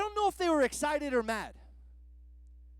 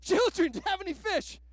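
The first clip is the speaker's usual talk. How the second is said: high, loud, mock-cheery hail; preacher imitating the stranger on the shore, high loud call; 8 semitones higher, 3 dB louder than usual. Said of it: "I picture Peter on the boat".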